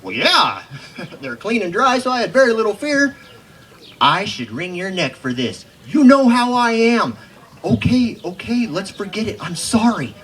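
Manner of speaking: loud, high, lively recital of a poem's quarrel; hammed-up cowboy poetry performance. Recites poem with dialogue voices.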